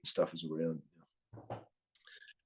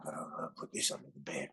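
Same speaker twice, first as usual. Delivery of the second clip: hushed, secretive whisper; 3 semitones lower, 11 dB quieter than usual. Real, not acted. Whispering to the interviewer about hiding records, hushed. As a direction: breathe it like a confession.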